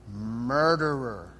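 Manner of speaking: long drawn-out, low accusation; Gollum. Acted. Sinister creature accusing his other self, drawn out and menacing. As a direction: stretch one word into a sinister accusation. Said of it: "switching back and forth".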